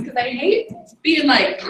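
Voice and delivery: ordinary talk, nothing marked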